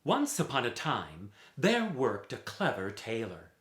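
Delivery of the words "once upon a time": ordinary delivery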